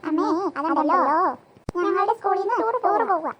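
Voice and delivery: put-on two children chorusing together; speaking in unison. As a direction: say it together to your mother. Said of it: "ഈ രണ്ടുപേരും ഒരുമിച്ച് പറയുന്നേ".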